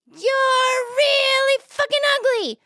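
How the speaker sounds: slow, high squawking parrot insult. Acted. Parrot insulting a passing lady, high drawn-out squawk. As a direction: stretch it out in a piercing parrot voice. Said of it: "He says".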